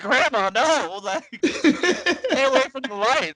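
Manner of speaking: high, loud melodramatic cry; mock desperate plea; hammed-up plea. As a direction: beg like in a soap opera. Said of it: "climbed on top of her and was like".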